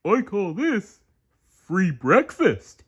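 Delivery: Patrick voice. A narrator's reading. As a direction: ask eagerly and slow. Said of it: "said Patrick".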